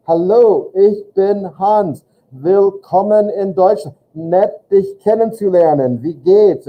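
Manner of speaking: high, drawn-out, slow as if to a dim listener; exaggerated slow talk for a foreigner; slow like he's stupid. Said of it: "he talks real slow like he's stupid".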